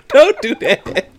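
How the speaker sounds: slow words broken by laughter; laughing. Real, not acted. Repeats warning while laughing.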